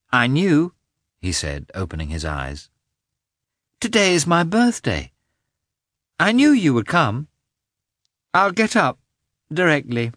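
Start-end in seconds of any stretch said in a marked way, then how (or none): 0.1-0.7 put-on high, louder child's voice; little boy just woken; young boy. A narrator's reading. Sleepy boy wakes to mother. 3.8-10.1 put-on high, loud child's voice; little boy eagerly greeting his mother; young boy. A narrator's reading. Boy waking on his birthday.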